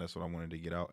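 ordinary talk

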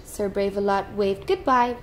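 usual speaking voice

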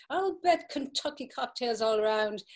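usual speaking voice

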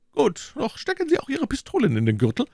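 high-pitched foreign gentleman's voice; English gentleman. Acted. English gentleman bargains with D'Artagnan, high voice. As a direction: answer high, with a foreign touch.

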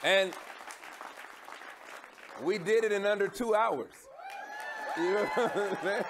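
hyped, shouting to the crowd; excited shout. Real, not acted. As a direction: pump up the room. Host hyping the crowd.

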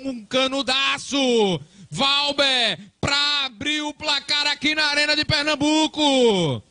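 high, loud goal commentary; excited shouting. Real, not acted. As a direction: keep yelling with the crowd's energy.

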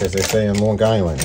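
ordinary talk

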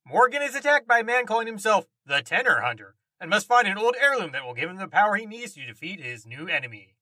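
ordinary talk, nothing marked

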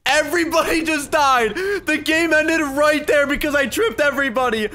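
high, loud amazed exclaiming; excited disbelief. Real, not acted. Gamer exclaiming as everyone in the server dies. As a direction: call it out in amazed glee.

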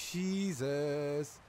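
normal talking voice

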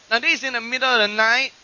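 ordinary talk, nothing marked

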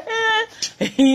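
high burst of laughter. Real, not acted. Vlogger bursting into high laughter at herself. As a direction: crack up high.

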